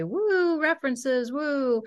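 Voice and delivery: high, whooping mock cheer; excited whoop. Teasing friends, retelling Dave's high mock whoop. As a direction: cheer it like a party crowd. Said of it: "triggers Dave to say".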